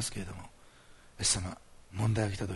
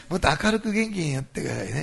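bright and lively; 5 semitones higher, 5 dB louder than usual. Real, not acted.

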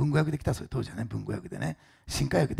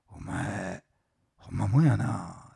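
slow, low Osaka dialect drawl; 4 semitones lower, much slower than usual. Retold or imitated. Osaka dialect president admiring the honest employee. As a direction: say it in Osaka dialect, impressed and weighing each word. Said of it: "大阪弁ですよ".

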